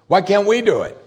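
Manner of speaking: high-pitched, baffled plea; puzzled protest. Acted. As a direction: demand to know why, pitched well up. Disciples' puzzled question, acted.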